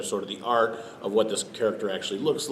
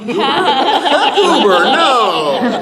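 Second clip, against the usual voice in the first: much higher, louder, slow laughing yell; panicked yell; 14 dB louder, much slower than usual. Acted. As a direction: react to a giant ape you can't see. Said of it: "The guy would just be like".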